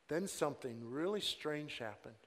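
ordinary talk

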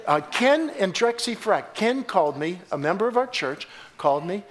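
ordinary talk

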